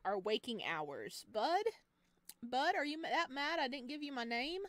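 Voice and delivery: normal talking voice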